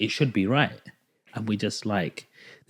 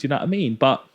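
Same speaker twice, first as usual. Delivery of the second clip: normal talking voice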